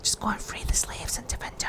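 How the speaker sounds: hushed, unvoiced whisper of a hidden god; breathy secret command; mysterious whisper. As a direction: whisper it like a voice in her dreams. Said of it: "And Solas is like".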